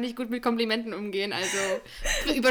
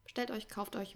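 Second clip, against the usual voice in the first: ordinary talk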